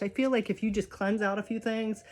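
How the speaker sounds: ordinary talk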